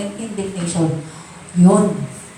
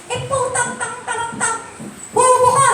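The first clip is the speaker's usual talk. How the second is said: mock angry scolding; 10 semitones higher than usual. Shown as a demonstration. Angry parent curses at child, mock outburst. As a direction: sputter then yell at the child in mock rage. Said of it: "Hindi mo sasabihin sa anak mo".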